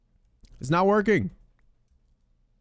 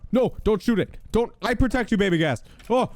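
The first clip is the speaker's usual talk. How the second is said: urgent plea; 9 semitones higher than usual. Real, not acted. Protecting ghast from skeleton.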